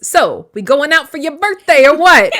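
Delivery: put-on loud Italian mobster accent. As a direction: ham up a Jersey mafia voice. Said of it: "We're gonna do offensive Italian accents".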